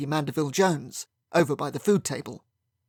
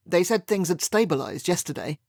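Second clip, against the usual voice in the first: usual speaking voice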